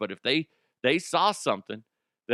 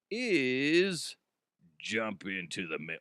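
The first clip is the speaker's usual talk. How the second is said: radio guy; 2 semitones lower, 3 dB quieter, slower than usual. Real, not acted. Host introducing the mailbag segment, too radio guy. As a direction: stretch the segue like a radio guy. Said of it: "I was going too radio guy with it".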